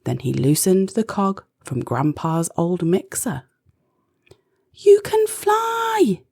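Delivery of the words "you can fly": high, slow delighted cry; overjoyed shout of joy; joyful cry. Acted. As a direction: cheer like a wish came true. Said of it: "Izzy cried".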